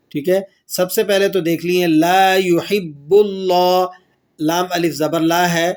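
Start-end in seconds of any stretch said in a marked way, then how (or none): none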